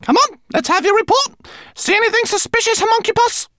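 high, brisk rat voice; Lola the rat asking. A narrator's reading. Lola the rat demands Twigleg's report, high and brisk. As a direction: pester him for a report. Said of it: "asked the rat".

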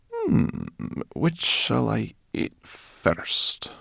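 slow, savouring brownie voice; greedy brownie voice. Acted. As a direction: drool over the choice slowly.

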